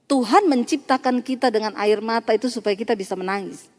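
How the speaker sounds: ordinary delivery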